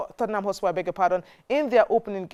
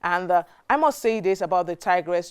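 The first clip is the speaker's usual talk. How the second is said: ordinary talk, nothing marked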